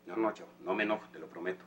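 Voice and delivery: low, very soft promise; soft low-pitched assurance; calm reassurance. Acted. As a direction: reassure a wary child, keeping it hushed. Man promises not to get angry.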